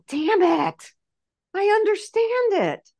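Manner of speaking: high squeaky resigned admission; exasperated squeal. Retold or imitated. Self admitting fault to soul, high squeaky resignation.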